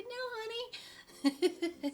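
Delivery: high, slow, sugary "no, honey" through a fake grin; forced sweet smile. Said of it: "took all I could to smile and say".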